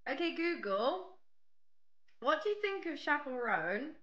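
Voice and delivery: put-on jokey silly accent asking Google. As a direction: ham up a goofy accent at the Google speaker. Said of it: "I was doing a silly accent".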